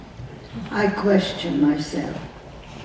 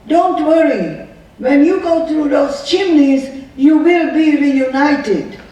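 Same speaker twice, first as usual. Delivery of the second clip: loud, higher cruel taunt; retold yell; 5 semitones higher, 7 dB louder than usual. Guard yelling a cruel taunt at the girl, a loud retold yell. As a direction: fling it mockingly across the yard. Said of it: "She yells at me".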